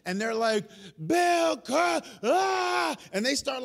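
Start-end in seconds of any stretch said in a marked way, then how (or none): 1.0-3.0 high-pitched mock cry to a god; mock yelling. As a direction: imitate frantic prophets calling out, high but not full volume. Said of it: "these prophets start yelling".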